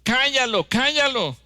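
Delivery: high, loud angry shout; shouting hush him. Retold or imitated. Disciples shouting to silence the beggar, loud high anger. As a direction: bark at them to silence him. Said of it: "los discípulos gritaban".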